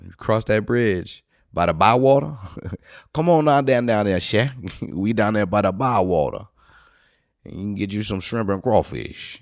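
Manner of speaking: playful put-on Cajun accent. Inviting folks down by the Bywater, mock Cajun accent. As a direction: welcome them down in a jokey Cajun accent. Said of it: "a Cajun accent? Is that Cajun?".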